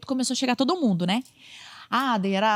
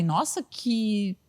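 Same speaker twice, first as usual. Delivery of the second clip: sarcastic wonder; 4 semitones higher, slower than usual. Retold or imitated. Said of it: "eu falei".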